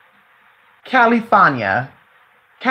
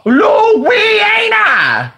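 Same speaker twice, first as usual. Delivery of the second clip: put-on much higher, loud, drawn-out yell at a child; mock mom yell; 11 semitones higher, 3 dB louder, much slower than usual. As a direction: play an angry mother calling her kid in. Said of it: "you go".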